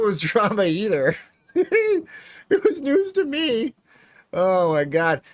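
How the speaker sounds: high, loud, laughing delivery. Real, not acted. Streamer learning of drama from chat, laughing. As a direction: say it through real laughter.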